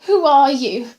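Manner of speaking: loud, high, frightened demand; fighting back tears. A narrator's reading. Patient to intruder in the dark, tearful fear. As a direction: cry it out, near tears. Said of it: "She was trying so hard not to cry".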